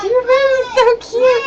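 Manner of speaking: put-on high, loud gushing over the baby; mock-gushing stranger; gushing onlookers. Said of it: "and they were like".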